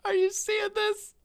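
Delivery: high, loud, laughing disbelief; laughing amazement. Real, not acted. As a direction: burst out laughing in awe. Overwhelmed by the game's view.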